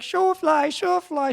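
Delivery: put-on high, loud calling of an old lady; old Russian lady. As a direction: call the horse's name like a frail old Russian woman. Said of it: "She was an old Russian lady".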